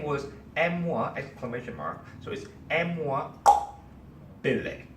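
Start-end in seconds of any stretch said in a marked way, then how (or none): 2.7-4.9 M-moi, a sharp tongue click, then Billy; click in name. Shown as a demonstration. Speaker demonstrates the African guest's name, click between M-moi and Billy. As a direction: pause after M-moi for a loud click before Billy. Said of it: "This sound".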